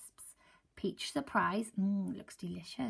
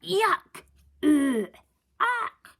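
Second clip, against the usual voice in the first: loud high disgusted cries; disgusted yuck; 9 semitones higher, 10 dB louder, much slower than usual. Acted. Seagulls tasting mustard sandwiches, loud disgusted cries. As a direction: spit it out in disgust.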